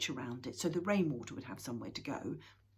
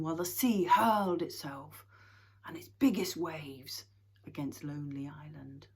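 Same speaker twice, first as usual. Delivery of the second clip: plain reading voice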